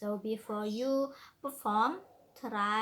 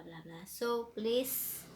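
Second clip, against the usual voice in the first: ordinary delivery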